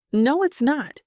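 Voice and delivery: insist, lifting the pitch to push back; insistent. A narrator's reading. Friend refuses to accept it's too late, insistent. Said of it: "Leah insists".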